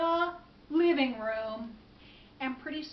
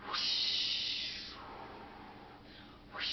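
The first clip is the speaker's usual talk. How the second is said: long, breath-only whoosh that fades; imitated rushing wind; 6 dB quieter than usual. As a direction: blow out the sound of a gust. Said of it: "And then the wind picked up".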